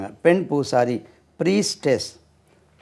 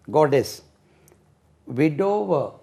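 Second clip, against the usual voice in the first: normal talking voice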